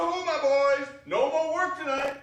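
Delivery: loud, high, booming holler; hearty old master calling out; jolly shout. Acted. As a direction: call the boys in with a jolly bellow. Fezziwig calling out to apprentices.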